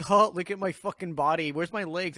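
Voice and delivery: high and loud, jokey; joking ghost dad. Retold or imitated. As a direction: play the dead dad laughing at himself. Dad's ghost joking about his body.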